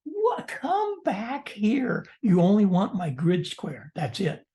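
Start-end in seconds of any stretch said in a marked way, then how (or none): 0.0-2.1 high, drawn-out plaintive call; stretched-out high mock plea; mock calling back. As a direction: call after someone who has gone, hammed up. Acted calling after vanished contact.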